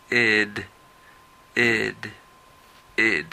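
"ed" said as a full syllable; teacher's model for repetition. Shown as a demonstration. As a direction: model "ed" slowly and clearly. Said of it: "la tercera sí es started".

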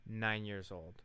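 ordinary talk, nothing marked

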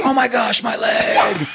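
very high, hammed-up shriek; mock panicked scream. Patient retelling waking to the same sight, mock panicked scream. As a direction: replay the scream of shock for comic effect. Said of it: "just starts screaming".

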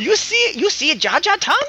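squeaky boastful Jar Jar Binks. Pretend. Jar Jar bit continues.

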